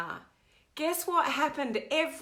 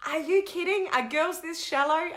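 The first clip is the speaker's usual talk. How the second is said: mock disbelief; 6 semitones higher than usual.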